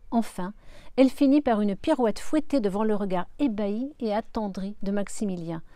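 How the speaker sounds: ordinary delivery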